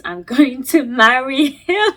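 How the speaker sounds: breaks into real laughter mid-line, slow; can't hold back a laugh while reading it. Real, not acted. Reading a stranger's post, she laughs at the marriage line. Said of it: "I don't know why I'm laughing".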